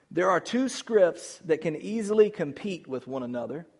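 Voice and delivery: usual speaking voice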